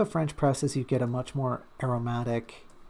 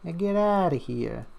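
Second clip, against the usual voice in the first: higher-pitched, hammed-up New York accent; 5 semitones higher, 4 dB louder than usual. Joking about living in Brooklyn, mock New York accent. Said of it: "that's not Brooklyn, that's just New York".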